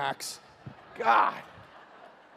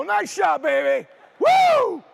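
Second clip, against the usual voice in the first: very high, loud whoop at the end; over-the-top dad celebration; ecstatic cheer; 10 dB louder, much slower than usual. Retold or imitated. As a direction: scream with pure joy for your boy. Father cheering son's shot.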